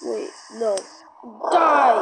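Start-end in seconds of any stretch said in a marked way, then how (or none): 1.5-2.0 yelled battle cry; shouting. Acted. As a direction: shout it at the enemy. Attacking in game.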